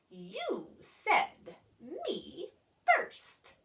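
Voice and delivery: a hint of a commanding creature; lightly voiced bossy creature. A narrator's reading. Creature bosses the pig, lightly voiced.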